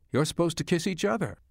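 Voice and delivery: high, lightly teasing prod; playful joking. A narrator's reading. Mother nudging the couple under the mistletoe, playfully joking. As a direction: pitch it up and chide them playfully. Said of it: "Mom joked".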